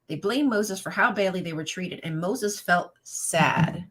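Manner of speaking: ordinary delivery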